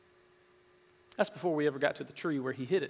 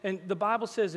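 ordinary talk

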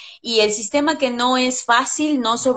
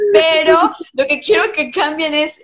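higher and louder, talking through laughter; laughing; 4 semitones higher, 4 dB louder than usual. Real, not acted. Teacher correcting her joke to students, talking through laughter. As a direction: still laughing as she takes back the joke.

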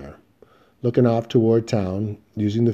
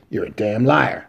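hoarse; 3 dB louder than usual. A narrator's reading. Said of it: "Rich said hoarsely".

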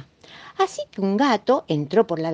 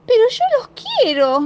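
much higher and louder wail; child's cry; 5 dB louder than usual. Acted. Marina protesting to mom about her pacifiers, wailing. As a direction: cry out in protest like a little girl. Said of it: "grité".

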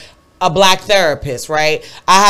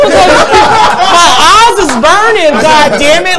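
mock pain; 11 semitones higher, 8 dB louder than usual. Eyes burning from mace.

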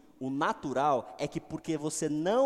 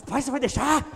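son egging on; 10 semitones higher, 8 dB louder than usual. Retold or imitated. Son in car eggs father on.

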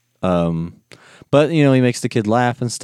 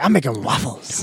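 silly impression; 3 semitones higher, 3 dB quieter than usual. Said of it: "he does the line wrong".

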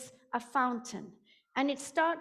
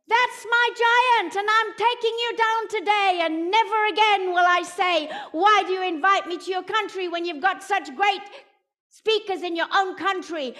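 shouted; 5 semitones higher, 10 dB louder than usual. Retold or imitated. Throwing a stone at the fountain, shouting. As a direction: hurl it out like a battle cry. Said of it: "I shouted".

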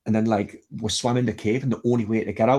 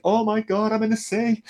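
much higher, half-laughing panic at being in the sea; a replayed moment of shock, pitched way up; retold panic; 12 semitones higher than usual. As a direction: squeal it out like you've just hit the cold water. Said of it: "But I was like".

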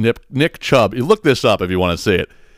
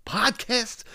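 mock disbelief; 11 semitones higher, 10 dB quieter than usual. Real, not acted. Incredulous at brother's podcast listening.

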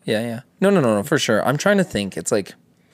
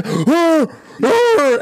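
rising, louder hammed-up shouts; mock angry grunts; 4 dB louder than usual. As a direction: bark out rising yells. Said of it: "He's like".

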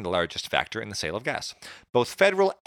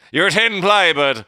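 much higher, louder hammed-up Irish accent; mock Irish accent; 8 semitones higher, 10 dB louder, slower than usual. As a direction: ham up a stage Irish accent. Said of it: "You're sounding really Irish".

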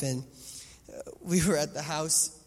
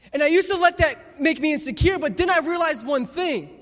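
louder, higher, driving sermon voice; full-force preaching; 8 semitones higher, 13 dB louder than usual. Acted. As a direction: preach it out to the whole hall.

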